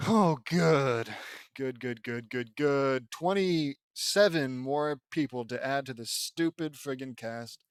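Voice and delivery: loud, exasperated string of "good"s; heavy sarcasm, dragging the words out; sarcastic dismay. Real, not acted.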